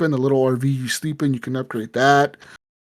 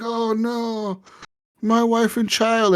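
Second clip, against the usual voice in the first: higher-pitched, drawn-out mock wail; mock lament; 7 semitones higher, much slower than usual. Imitated prisoner lamenting his family while handcuffed, mock lament. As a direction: imitate a despairing man, stretching the words.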